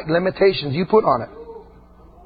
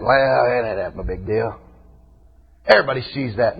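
lightly voiced shrug; 6 semitones lower than usual. Shimei shrugging off seeing the king, mildly mocked. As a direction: toss it off like it's nothing, lightly mocked.

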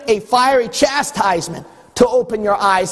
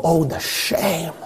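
mock shame; 4 semitones lower, 4 dB quieter, slower than usual. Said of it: "And you go".

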